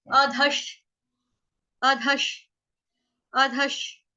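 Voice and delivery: अधश with a half श, said slowly; pronunciation demo. Shown as a demonstration. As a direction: clip the श short after अध. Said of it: "तो इसका उच्चारण हम करेंगे".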